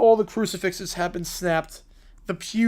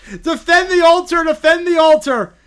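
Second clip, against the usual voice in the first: loud, higher battle call; rallying shout; 6 semitones higher, 9 dB louder than usual. Pretend. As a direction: call the troops to the altar. Rallying cry in the fight.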